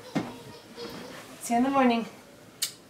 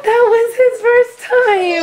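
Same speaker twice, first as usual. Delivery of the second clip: happy crying shout with laughter; elated, half laughing, half sobbing; excited; 10 semitones higher, 4 dB louder than usual. Real, not acted. Baby's first words, mom overjoyed.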